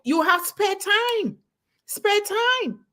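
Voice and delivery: bright, thrilled stress on spare time; excited emphasis. Real, not acted. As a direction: lift it up with delight, drawing out spare time.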